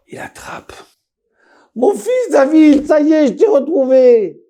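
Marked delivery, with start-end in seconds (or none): 1.8-4.4 joyful cry. Acted. Father cries out on finding his son David. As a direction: shout it out like finding a lost child.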